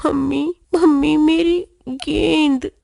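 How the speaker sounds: wistful calling. A narrator's reading. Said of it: "Pappu याद करते हुए".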